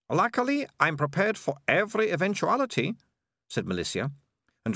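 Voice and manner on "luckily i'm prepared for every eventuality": put-on higher, bright-eyed girl voice, confident; lightly voiced eager girl. A narrator's reading. Malicia boasting to Morris, higher girl voice. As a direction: play the well-prepared young heroine, pitched up.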